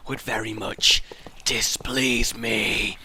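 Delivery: whispered, slow menacing displease me; sinister whisper. Pretend. Boss threatening over uneven road, a slow whisper. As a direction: hiss it like a villain.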